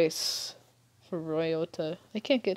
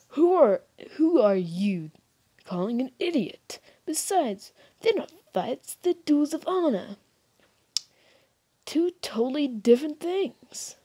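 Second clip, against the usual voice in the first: put-on higher-pitched, offended young fighter; indignant duelist; 7 semitones higher, 5 dB louder than usual. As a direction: protest that these are honorable duels. Voices offended character.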